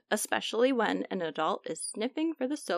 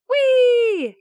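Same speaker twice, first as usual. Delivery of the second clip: very high, loud gleeful cry; gleeful whee; 13 semitones higher, 10 dB louder than usual. Acted. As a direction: shout with joy down a slide. Germ slides down the drain.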